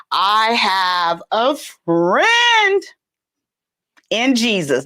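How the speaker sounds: very slow, every word stretched; mock proclamation. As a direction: draw out "friend" as a grand announcement.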